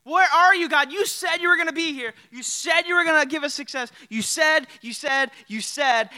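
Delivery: high, loud desperate plea to God; frantic pleading, voice cracking upward; desperate Joshua. Retold or imitated. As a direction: cry out like a man abandoned by God. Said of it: "He's like".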